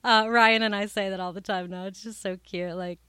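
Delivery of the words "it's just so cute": ordinary talk, nothing marked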